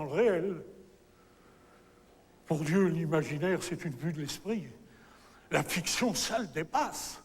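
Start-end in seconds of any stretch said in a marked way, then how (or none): none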